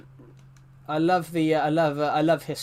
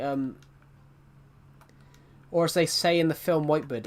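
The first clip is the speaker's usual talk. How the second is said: normal talking voice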